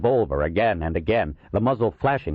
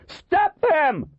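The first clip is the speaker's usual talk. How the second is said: high, desperate shout; panicked high-pitched cry; 4 dB louder than usual. A narrator's reading. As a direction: scream the order back over a shoulder. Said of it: "Waxy cried over his shoulder".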